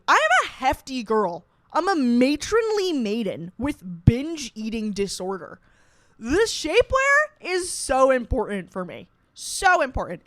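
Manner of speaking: loud, emphatic comic despair; dramatic lament. Real, not acted. Bridesmaid explaining why shapewear matters, dramatic lament. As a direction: lean hard on every aggrieved word.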